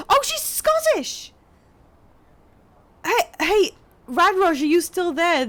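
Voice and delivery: high, loud delighted discovery; excited calling out. Real, not acted. Streamer calling Radrosh over a Scottish character, excited. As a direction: call a viewer back with high excitement.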